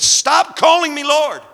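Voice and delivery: a high, slow mock scream from heaven; retold divine scream. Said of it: "God screamed at me from heaven".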